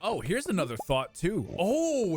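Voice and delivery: normal talking voice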